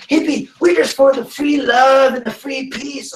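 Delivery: high, loud dopey hippie voice; mock hippie. Mocking hippies to a guest, high hippie voice. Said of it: "they're like".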